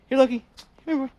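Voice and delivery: high, loud call to the dog. Real, not acted. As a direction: call him over, bright and loud. Calling the dog to play.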